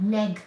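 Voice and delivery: Neg said with a short e, as in neck, not nag; correct pronunciation. Shown as a demonstration. Correcting a viewer's spelling, short e in Neg. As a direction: spell it out firmly: Neg rhymes with peg. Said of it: "not nag".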